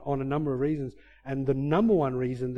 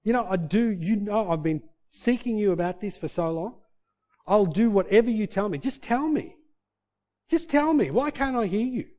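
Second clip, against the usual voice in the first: re-enacted exasperated plea, climbing higher; frustrated pleading; 6 semitones higher than usual. Preacher recalling begging God to talk to him, frustrated. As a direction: plead upward, more insistent each time. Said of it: "I got so frustrated".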